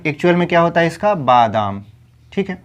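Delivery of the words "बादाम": usual speaking voice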